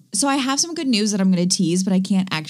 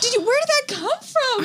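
much higher, alarmed and startled. Real, not acted. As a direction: blurt it out in real alarm. Startled by a moth.

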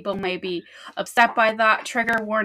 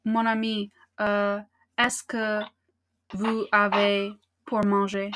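put-on French accent. French café role play.

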